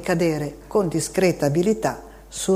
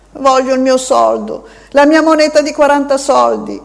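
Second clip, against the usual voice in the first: high, louder crying demand; a weeping boy demanding his coin; tearful child's shout; 8 semitones higher, 8 dB louder than usual. A narrator's reading. Boy to the man on his foot, high tearful shout. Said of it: "Il bambino piangeva".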